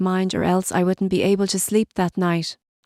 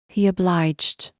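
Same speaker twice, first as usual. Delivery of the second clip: usual speaking voice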